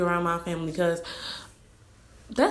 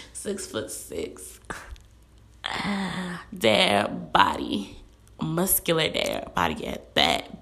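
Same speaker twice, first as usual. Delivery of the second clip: much lower, slow, breathy swoon over her dream man; dreamy swooning; 4 semitones lower, slower than usual. Real, not acted. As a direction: melt into the fantasy, slowly.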